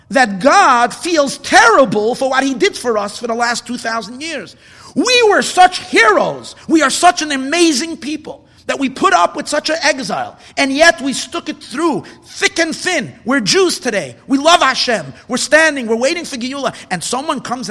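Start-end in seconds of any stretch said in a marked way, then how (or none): 5.0-12.8 rousing shout. Real, not acted. Preacher rousing listeners about surviving exile, loud and proud. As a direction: thunder it out to the congregation. Praising the people's endurance in exile.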